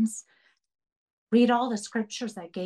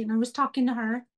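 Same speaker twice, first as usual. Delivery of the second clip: ordinary delivery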